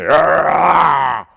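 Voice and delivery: loud, drawn-out battle roar; war cry roar. Acted. Goliath coming down to battle, roaring a war cry. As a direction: roar like a champion charging in. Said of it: "shouting its war cry".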